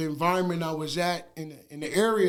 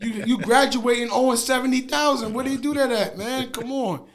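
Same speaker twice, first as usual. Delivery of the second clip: exasperated; 6 semitones higher, 3 dB louder than usual. Real, not acted. Exasperated rant on college debt.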